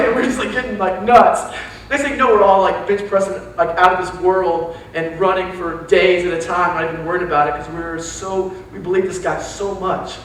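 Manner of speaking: ordinary talk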